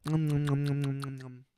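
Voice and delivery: soft mock ASMR chewing sounds; mock ASMR eating. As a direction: nom nom into the mic, mock ASMR. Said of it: "it's A S M R".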